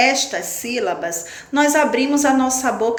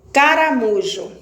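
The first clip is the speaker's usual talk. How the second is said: ordinary talk, nothing marked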